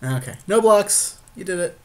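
ordinary talk, nothing marked

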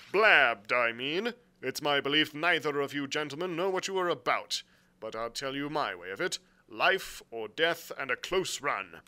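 loud, emphatic captain; blunt sea captain. Acted. Captain warning the gentlemen of danger, bluntly. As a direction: lay it out forcefully. Captain's dialogue beside narration.

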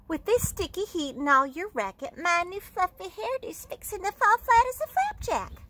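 lightly voiced whiny teen, high at the end; fussy big sister. Older sister griping about her hair, lightly whiny. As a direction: complain about your hairdo.

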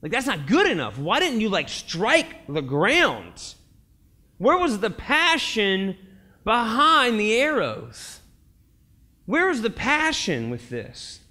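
worked-up, slow-hammered challenge; exasperated Elijah. Acted. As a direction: demand to know where the passion went. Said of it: "And Elijah was like".

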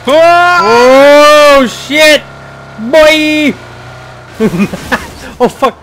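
chillido fuerte y agudo de susto; grito emocionado. Real, not acted. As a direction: gritando en caída libre. Grita al saltar del avión. (grito muy fuerte y agudo)

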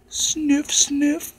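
high, loud sniffing; sniffing the air. Acted. Imitating sniffing sound.

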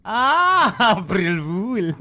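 exaggerated realization. Real, not acted. Co-host catching on to the April Fool joke, exaggerated realization. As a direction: stretch it out as the joke dawns.

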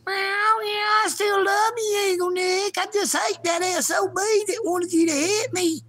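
high, whiny cat voice with a tearful edge; talking kitty voice. Pretend. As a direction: voice the offended kitty still loving its owner. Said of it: "don't be using my lines there, kitty".

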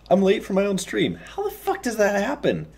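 high-pitched exasperated disbelief; incredulous outburst. Real, not acted. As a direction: throw the question up in disbelief. Exasperated at being late.

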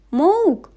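high loud bleat; a strange beast calls; creature call. A narrator's reading. Creature calling as the door creaks, loud.

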